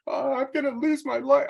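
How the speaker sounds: put-on high, loud, frantic teen voice; mock panic, high and shrill; panicked daughter. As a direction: imitate his daughter freaking out after backing into someone. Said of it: "freaking out".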